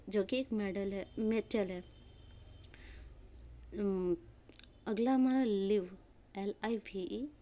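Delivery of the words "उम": usual speaking voice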